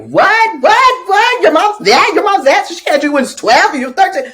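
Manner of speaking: high, loud disbelieving mock shouts; teasing students. Students mock boy about young mom, high loud teasing shouts. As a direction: whoop like rowdy kids egging a classmate on. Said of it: "the students in the background were like".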